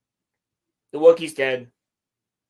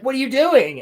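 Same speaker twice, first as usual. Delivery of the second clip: high, loud startled question; hammed-up shock at being trapped; mock alarmed cry; 9 semitones higher, 6 dB louder than usual. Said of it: "he's like".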